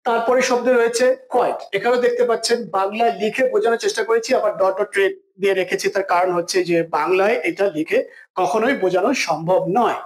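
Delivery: ordinary delivery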